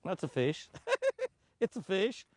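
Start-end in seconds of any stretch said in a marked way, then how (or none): 0.7-1.3 laugh. Real, not acted. Laughing at mistaking grass.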